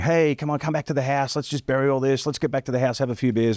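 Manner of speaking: lightly voiced pal urging him back for beers; coaxing Robert. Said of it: "it's Robert saying".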